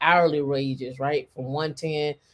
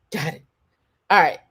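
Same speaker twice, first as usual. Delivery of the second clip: normal talking voice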